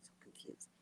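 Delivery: confused words whispered almost silently. Real, not acted. Whispered aside.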